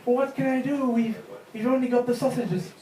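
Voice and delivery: a lightly voiced flustered husband; helpless husband. Husband at a loss over sausages, mock helpless.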